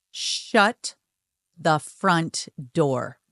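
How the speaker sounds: slow, punched-out astonishment; imitating a stunned friend, drawn out; mock disbelief. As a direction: stretch each word in retold shock. Friend's shocked reaction, retold.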